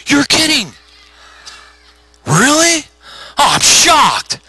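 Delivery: high-pitched, sarcastic astonishment; mock shock. Pastor teasing mother of teenager, mock shock. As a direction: feign huge surprise, stretching it out.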